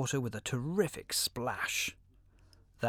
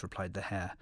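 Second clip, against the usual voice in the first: plain reading voice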